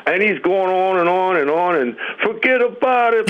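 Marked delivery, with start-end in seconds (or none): 2.2-3.3 higher, light New York accent imitation; imitating a New York radio host's accent. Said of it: "he's got that accent".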